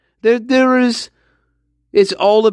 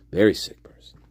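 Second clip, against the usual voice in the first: hushed whispered aside; 11 semitones lower, 5 dB quieter, faster than usual. Shown as a demonstration. Host whispers his verdict on the killer to listeners. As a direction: drop to a whisper on the repeat.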